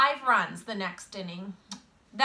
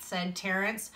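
plain reading voice

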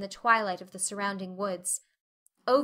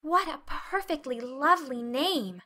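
awed delight; 5 semitones higher than usual. A narrator's reading. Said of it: "with a long indrawing of breath".

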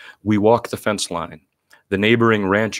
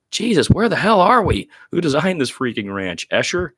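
hammed-up frustrated yelp; exasperated outburst; 9 semitones higher than usual. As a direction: throw up your hands and wail it. Lost men exclaim in frustration.

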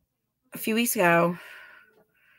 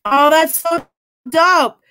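excited; 6 semitones higher, 5 dB louder, slower than usual. Real, not acted. Reacting to chat praise.

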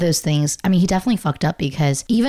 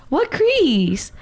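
high-pitched, drawn-out mock denial; exaggerated high incredulous question; mock disbelief; 11 semitones higher than usual. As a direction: squeal it in put-on disbelief. Playfully denying friend's eye creases.

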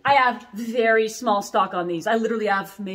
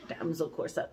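softer, laughing through the name; 3 semitones lower, 10 dB quieter than usual. Real, not acted. Repeating the corset name to viewers, laughing. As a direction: giggle it out under your breath.

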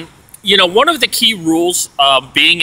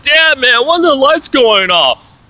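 impatient complaint; 9 semitones higher than usual. Retold or imitated. Roommate grumbling about the lights, impatient whine.

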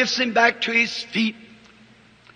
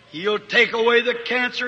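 ordinary talk

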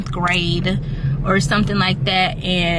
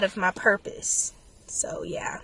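fading to whisper; 3 semitones lower, 9 dB quieter than usual. Real, not acted. Emotional, unsure of purpose.

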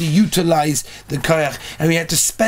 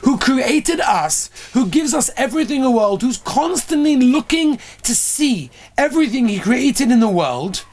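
preaching; 5 semitones higher than usual. Acted. Preaching about God's greatness.

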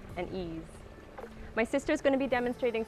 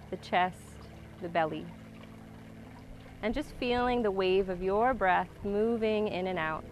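calm grounding exercise. Acted. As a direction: guide the breath, calm and unhurried. Guides a slow breathing practice.

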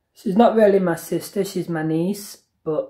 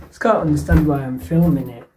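ordinary talk

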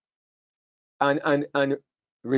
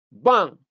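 loud, sharp spoken bang; imitated thud; mock bang; 4 semitones higher, 5 dB louder than usual. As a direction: punch the word like a blow. Sound of the blow.

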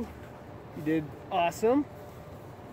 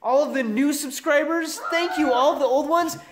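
upbeat, raised outro voice; vlog outro; 6 semitones higher, 3 dB louder than usual. Acted. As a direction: sign off to the viewers with energy.

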